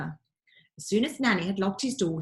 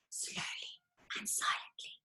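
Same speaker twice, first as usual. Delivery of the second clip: barely voiced, very soft and creeping; hushed; 15 dB quieter than usual. A narrator's reading. Narrator hushing as the boy creeps past the guard, near silent. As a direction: breathe the words as if sneaking past a guard.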